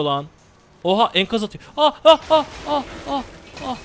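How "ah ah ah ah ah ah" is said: sharp high cries of alarm; panicked high yelps. Real, not acted. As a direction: yelp as the hits land. Hit by debris in game. (yüksek sesli panik çığlıkları)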